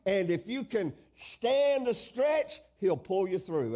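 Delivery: rousing sermon promise; fervent preaching. Acted. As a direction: preach it with conviction, stretching key words. Preaches assurance at full force.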